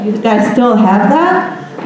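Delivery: ordinary delivery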